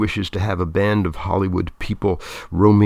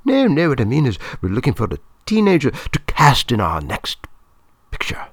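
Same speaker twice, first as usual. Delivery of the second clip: high-pitched woman hurrying to clarify; Claudia, correcting; 6 semitones higher, 3 dB louder than usual. A narrator's reading. Film woman clarifying the casting to Mister Lopat, high-pitched. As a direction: start high, correcting a misunderstanding.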